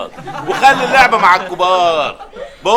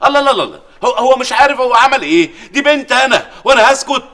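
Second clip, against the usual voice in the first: higher, louder outrage over the kidnapping; outraged; 6 semitones higher, 3 dB louder than usual. Acted. Father on phone about kidnapped daughter, outraged. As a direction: erupt in indignant fury.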